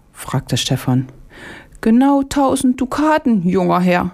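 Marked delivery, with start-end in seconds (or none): 1.8-4.1 high, sly little market-woman voice; a vegetable seller naming her steep price; old market woman. Acted. Market woman quoting the price to Stefan, in a high, sly voice. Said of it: "antwortete die Gemüsefrau".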